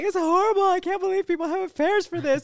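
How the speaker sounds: high, loud dismayed wail; mock distraught. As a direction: wail it like the world ended. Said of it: "So I was like".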